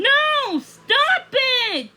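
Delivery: high, loud cry of no; panicked frog begging them to stop; frantic protest. Acted. Frog protesting the plan, high and loud. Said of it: "John Bob protested".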